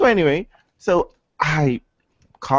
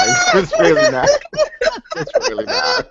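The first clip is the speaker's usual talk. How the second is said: much higher sing-song denial; mock denial. Host joking against conspiracy theorists, high mock denial. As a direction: deny it in a squeaky voice.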